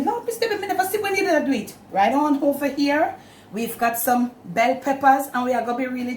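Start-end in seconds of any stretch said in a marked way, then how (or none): 0.0-1.7 high mock viewer voice, baffled; imitating a puzzled viewer. Cook mimics a viewer who missed the gravy step, high mock voice. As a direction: pitch it way up like a confused viewer. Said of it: "Me no want nobody say".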